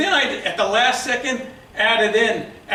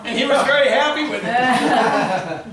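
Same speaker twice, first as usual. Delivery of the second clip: laughing; 5 dB louder than usual. Real, not acted. Laughing through the punchline.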